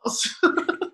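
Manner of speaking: high, loud mock British wail; slow, hammed-up British shouting; shouted British accent. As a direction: cry it out in a silly British accent. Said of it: "me shouting in a British accent".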